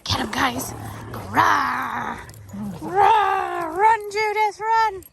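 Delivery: loud, high cheer at playing dogs; excited cheering. Real, not acted. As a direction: egg the dogs on, shouting.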